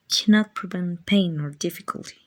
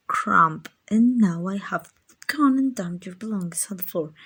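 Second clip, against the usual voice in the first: ordinary talk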